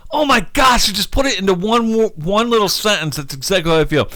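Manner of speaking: high-pitched retold amazement; excited realization. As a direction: gush it like a sudden revelation. Acting out delighted reaction to preacher.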